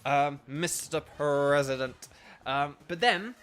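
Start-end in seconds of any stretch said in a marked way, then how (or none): 0.5-2.1 MISTER PRESIDENT slow and stretched out; mock-grand announcement. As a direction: draw out "Mister President" like a pompous salute. Mock salute, name drawn out.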